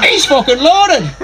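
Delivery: high, drawn-out Irish outburst; hammed-up high, slow exclamation; exclaiming Irishman. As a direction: stretch it out high as the indignant Irishman. Acting an Irishman's reaction.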